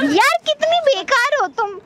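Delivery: high, drawn-out whine; whiny sisterly exasperation; exasperated protest. Acted. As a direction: protest in a slow, high-pitched huff. Sister protests after being sent away.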